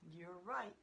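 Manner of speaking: ordinary delivery, nothing marked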